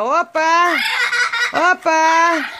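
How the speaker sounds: high-pitched mock parrot shout; imitando grito de papagaio. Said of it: "Cueca e grita". (grito agudo imitando papagaio)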